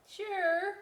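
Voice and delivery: the potato answers. Acted. Potato voiced.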